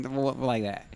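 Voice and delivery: ordinary delivery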